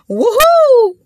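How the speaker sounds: a high, loud whoop of delight; high cheer. Real, not acted. Hyping the ladies in the audience, a high loud whoop. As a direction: whoop like you're hyping the room. Cheering her own advice.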